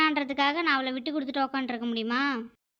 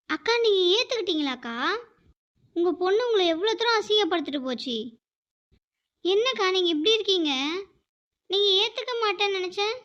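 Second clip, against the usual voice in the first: put-on high, startled question to an older sister figure; shocked disbelief; 6 semitones higher than usual. Neighbour Rani can't believe grandma took her daughter back, shocked and high. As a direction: gasp it out in disbelief.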